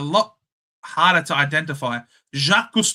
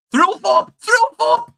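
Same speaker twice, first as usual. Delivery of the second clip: building shout, much higher and louder; excited shout; 6 dB louder, slower than usual. Real, not acted. Streamer hyping a player's goal: rising excited shout. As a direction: scream the name as the goal goes in.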